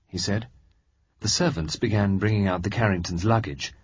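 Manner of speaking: ordinary delivery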